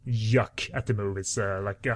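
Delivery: ordinary talk, nothing marked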